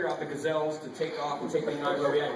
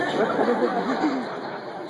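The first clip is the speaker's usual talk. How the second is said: laugh; 8 semitones higher, 6 dB louder than usual. Real, not acted. Laughs at own digression.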